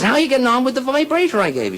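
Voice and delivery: high, hearty Irish husband; Murphy. Acted. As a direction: call down the phone as Murphy. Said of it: "He says".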